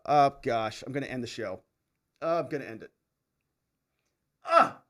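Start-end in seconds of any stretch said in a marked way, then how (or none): 4.4-4.8 short high shriek of horror; horrified shriek. Real, not acted. Host recoiling from a viewer's pizza order with a horrified shriek. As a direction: let out a yelp as if burned. Reacting to olive pineapple pizza.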